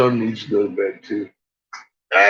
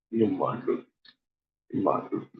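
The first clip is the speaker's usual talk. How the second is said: mumbled aside; 2 semitones lower, 8 dB quieter, faster than usual. Real, not acted. Mumbles to dog about cookies.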